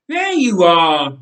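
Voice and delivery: loud, slow call; shouted. A narrator's reading. Nathan finding his cousin at the front, loud. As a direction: call out across the crowd. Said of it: "he shouted".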